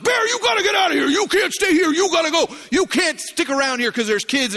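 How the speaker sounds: very high, shouted shooing; panicky racket. Retold or imitated. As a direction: yell at the bear, easing slightly by the end. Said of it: "we're making all this racket".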